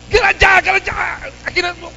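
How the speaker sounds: high, loud scolding yell; parent yelling. Retold or imitated. Parent yelling at a child, loud scolding. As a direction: yell at your kid across the yard. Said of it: "Paano ka sumigaw?".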